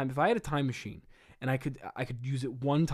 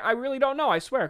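frightened pleading; 9 semitones higher, 5 dB louder than usual. Acted. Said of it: "threatens to hit him again".

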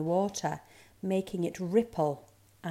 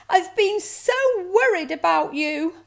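high, loud, emphatic fretting; worried mother; 11 semitones higher, 9 dB louder than usual. A narrator's reading. Mother scolds found cub fondly, worried. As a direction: fuss over a child just found.

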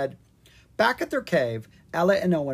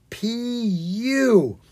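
disgusted; 9 semitones higher, 6 dB louder than usual. A narrator's reading. Monster's awful smell.

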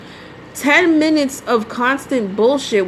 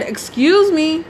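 higher, slow, drawn-out "Excuse me" with attitude; 7 semitones higher, 3 dB louder, slower than usual. Retold or imitated. To the driver still ranting on the phone, sharp with attitude. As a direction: stretch "Excuse me" out, fed up and pointed. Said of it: "I had to say with an attitude".

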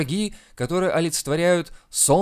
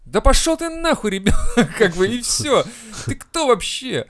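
mock angry shout; 8 semitones higher, 5 dB louder than usual. Shouting at imagined child.

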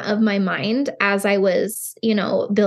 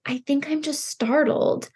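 put-on soft breathy whisper of a toddler; small child voice; 4 semitones higher, 4 dB quieter than usual. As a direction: whisper it like a little boy answering mom. Quoting her small son.

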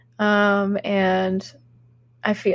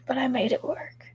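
whispered aside; whispering; 2 semitones higher, 8 dB quieter than usual. Real, not acted. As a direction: drop to a breathy whisper. Quiet aside about being sick.

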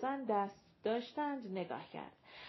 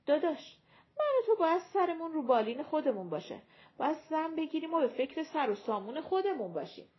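imagined brother's voice; 6 semitones higher, 3 dB louder, faster than usual. Retold or imitated. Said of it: "بهتره برادره بگه".

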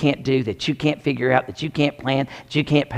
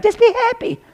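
much higher, sing-song mock cheer; mocking advice; 5 dB louder than usual. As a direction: send up the empty "be happy" advice. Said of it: "I can be happy, right?".

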